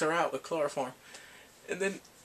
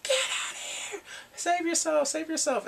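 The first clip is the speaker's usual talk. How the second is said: very high, strained pleading yell; mock desperate cry; 13 semitones higher than usual. Chained Bongsu urging him away, mock desperate scream. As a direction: imitate a frantic warning cry. Retold plea beside a bomb.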